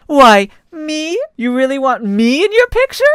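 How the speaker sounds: flattered disbelief; surprised. Acted. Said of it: "looked surprised".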